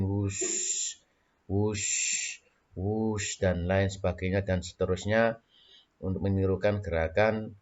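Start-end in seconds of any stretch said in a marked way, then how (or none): 0.0-3.4 blowing wind sound; wind whoosh. Shown as a demonstration. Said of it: "sambil bersuara angin".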